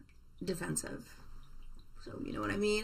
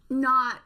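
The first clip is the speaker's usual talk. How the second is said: loud, higher stress on NOT; emphatic correction; 5 semitones higher, 9 dB louder than usual. Real, not acted. Tidying up her swearing for viewers, emphatic NOT. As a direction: punch out NOT, louder and higher, on its own.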